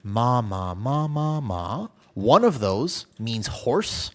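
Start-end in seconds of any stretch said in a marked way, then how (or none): 0.0-1.9 ma repeated, each ma pitched on its own tone; walk ma through rising and falling tones; five Thai tones again. Shown as a demonstration. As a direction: tonal demo of ma. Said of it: "completely different words".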